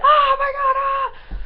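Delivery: high, loud shriek of excitement; excited fangirl scream. Retold or imitated. Screamer reenacting own outburst at a gig, high fangirl scream. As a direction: squeal it out like a screaming fan. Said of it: "I obviously went".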